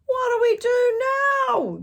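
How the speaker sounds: very loud, high panicked shout; yelled. A narrator's reading. Pirate panicking after the mast splits, loud high shout. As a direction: scream it over the storm. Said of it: "yelled one of the pirates".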